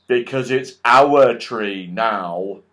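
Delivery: loud, slow, odd goblin voice; smug goblin. Acted. Goblin tells Joe the tree is theirs now, slow and smug. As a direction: lay claim to the tree, slowly and smugly.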